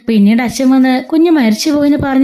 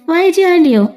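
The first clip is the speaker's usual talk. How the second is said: surprised question; 6 semitones higher than usual. Acted. Manu surprised on phone.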